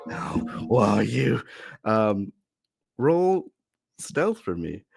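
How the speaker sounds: usual speaking voice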